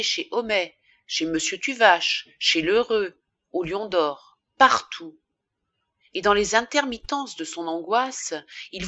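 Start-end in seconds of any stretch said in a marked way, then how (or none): none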